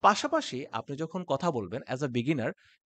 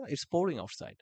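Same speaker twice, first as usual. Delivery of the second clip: soft, casual "It's pouring outside"; natural expression; 2 semitones higher, 4 dB quieter, much slower than usual. Shown as a demonstration. Teacher showing the natural expression, softer. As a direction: say it lightly and naturally. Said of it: "simply বলতে পারেন".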